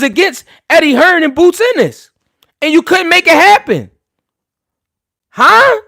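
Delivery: loud, high-pitched incredulous rant; heated challenge. Real, not acted. Streamer calling out a boxer's father over negotiations, heated challenge. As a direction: fire the questions out, nearly shouting. Ranting challenge to absent rival.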